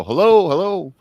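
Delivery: loud calls into an empty house; calling out. Retold or imitated. Man calling into his dark house, loud and uneasy. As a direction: call out to nobody, a little spooked. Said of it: "yelling to nobody".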